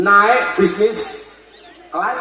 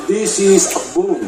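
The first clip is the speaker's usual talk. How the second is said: slow explaining; slower than usual. Retold or imitated. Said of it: "Akhirnya saya jawab".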